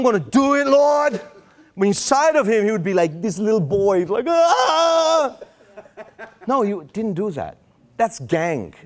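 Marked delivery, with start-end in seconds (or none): none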